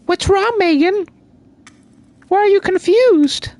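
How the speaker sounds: very high squeaky voice; squeaky Megan voice. Pretend. Voicing Megan the confused pet, very high squeaky voice. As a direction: squeak it out like a tiny confused creature. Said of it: "Megan says".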